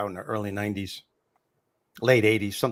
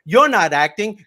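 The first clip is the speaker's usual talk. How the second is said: louder and higher punchline; punchline shout; 9 semitones higher, 9 dB louder than usual. Retold or imitated. Comic delivering the put-down to the heckler, raised and loud. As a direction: hit the put-down hard.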